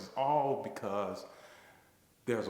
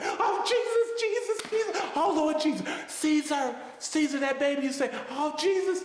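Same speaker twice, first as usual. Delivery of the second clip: very high, loud ecstatic crying out to Jesus; Holy Ghost shouting; 8 dB louder than usual. Retold or imitated. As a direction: cry out to the Lord in rapture. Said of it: "she went into a Holy Ghost".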